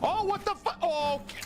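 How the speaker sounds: high startled shrieks; panicking gamer. Real, not acted. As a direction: panic as enemies jump out. Jump scares in game.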